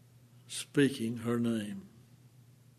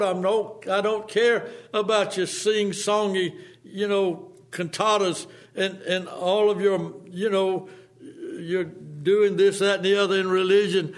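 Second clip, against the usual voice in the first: desperate pleading; 6 semitones higher, 5 dB louder than usual. Retold or imitated. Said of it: "when I kept on pressing".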